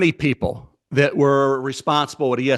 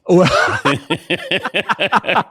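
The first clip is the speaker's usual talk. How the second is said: laughing; 2 semitones higher, 4 dB louder than usual. Real, not acted. Laughs at the "hair" joke.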